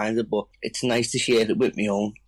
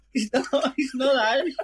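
laughing through the words, pitched up; can't stop laughing while insisting. Real, not acted. Laughing at friend's story.

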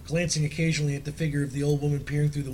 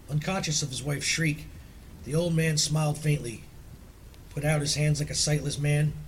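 plain reading voice

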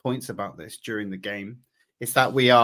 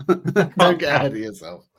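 chuckling as you tell him off; laughing through it; 3 semitones higher, 8 dB louder than usual. Real, not acted. Host teasing his co-host about the games, laughing.

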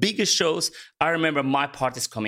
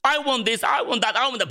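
put-on high, pushy little kid demanding things; mock a greedy child begging, pitched up; whiny kid; 7 semitones higher than usual. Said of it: "So as a kid, you're like".

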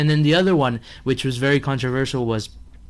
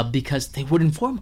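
ordinary talk, nothing marked

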